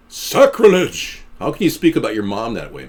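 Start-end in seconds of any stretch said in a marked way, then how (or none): 0.1-1.2 mock outrage. Mock outrage at mom joke.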